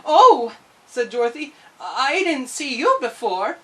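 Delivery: high, loud startled oh. Acted. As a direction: jump at the tiny man. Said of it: "Dorothy startled".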